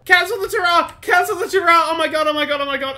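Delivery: high, loud, urgent cry; panicked retraction. Real, not acted. Player seeing the spider back, panicked shouting. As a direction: shout frantically to call it off. Spider returns during sign-off.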